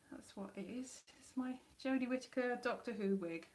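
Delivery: ordinary delivery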